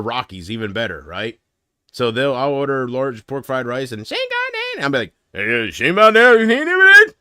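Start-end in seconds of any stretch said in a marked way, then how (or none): 4.0-4.8 mock gibberish. Mock Chinese gibberish order. 5.3-7.1 louder, rising into a high pumped-up yell; Schwarzenegger impression. Ordering at the Chinese takeout as an action hero, loud and rising. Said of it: "in Schwarzenegger's voice".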